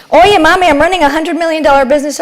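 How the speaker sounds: excited daughter showing off; eager daughter. Retold or imitated.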